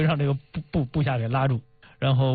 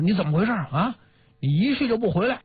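higher-pitched mock telling-off; retold scolding; 6 semitones higher than usual. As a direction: act out an accusing scold, pitch climbing. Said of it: "这个副将军大骂说".

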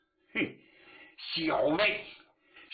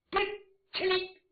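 magic command. Acted. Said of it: "用仙气一吹".

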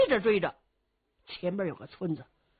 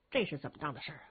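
much lower, softer repeat of 这是怎么当的事儿啊; muttering to himself; 9 semitones lower, 9 dB quieter, much faster than usual. Acted. The warrior mumbles the same question to himself, low and soft.

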